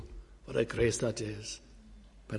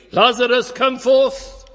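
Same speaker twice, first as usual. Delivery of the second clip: high, loud commanding call; loud cry; 12 semitones higher, 11 dB louder than usual. Acted. Christ crying out to Lazarus in the grave, a loud high command. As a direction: cry it out to the tomb. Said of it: "And he cries with a loud voice".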